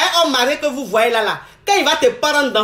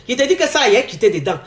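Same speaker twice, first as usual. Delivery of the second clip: usual speaking voice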